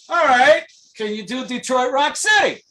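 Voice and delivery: high, loud retold call-out; acted-out eager shout; shouted challenge. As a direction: call the challenge out across a stage. Retelling drummer's challenge from stage.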